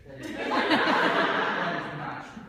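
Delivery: indignant laugh. Acted. Fernando to Chris during the breakup, laughing denial. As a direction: laugh off the accusation.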